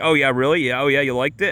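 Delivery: put-on skeptical girlfriend retort. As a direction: retell her challenging, doubtful questions. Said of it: "thought I was, like, trolling".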